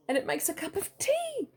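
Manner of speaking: gasping in amazement; breathless wonder; amazed gasp. Real, not acted.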